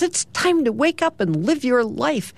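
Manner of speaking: high-pitched, insistent wake-up; little sister's wake-up call. Acted. Ruthie rouses brother from bed.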